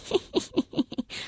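hohes, leises Kichern des Strauchs; schadenfrohes Lachen. A narrator's reading. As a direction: kichere gemein über die Kinder. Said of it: "lachte der Brombeerstrauch". (hohes schadenfrohes Kichern)

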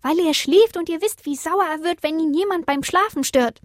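high, small creature voice; high-pitched protesting explanation; little star explaining. A narrator's reading. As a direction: answer back as a tiny star. Little star answers the others.

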